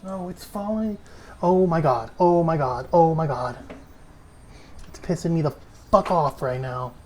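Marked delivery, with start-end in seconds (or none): none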